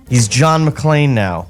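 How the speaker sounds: ordinary delivery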